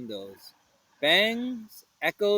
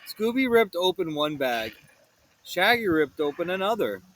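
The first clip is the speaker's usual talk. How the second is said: ordinary delivery, nothing marked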